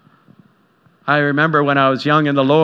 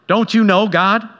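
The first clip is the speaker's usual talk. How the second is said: complaining to God; 7 semitones higher than usual. Retold or imitated.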